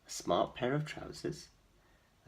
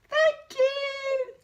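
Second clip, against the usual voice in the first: very high, louder mouse squeak; squeaky thanks; 9 dB louder than usual. A narrator's reading. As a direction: squeak your thanks as the mice. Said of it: "they squeaked".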